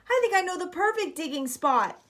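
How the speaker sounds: eager shout. A narrator's reading. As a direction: shout the good news over the wind. Boy keeps shouting over wind.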